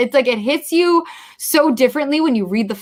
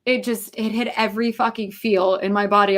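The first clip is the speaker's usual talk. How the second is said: ordinary delivery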